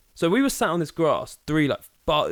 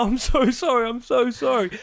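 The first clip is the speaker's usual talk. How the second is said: high, pleading retold voice; panicked apology; 9 semitones higher than usual. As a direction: plead like a terrified teenager. Boy pleading after being insulted.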